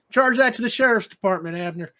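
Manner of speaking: a loud cowboy lawman calling out to the barkeep. Acted. GM voicing Virgil the sheriff.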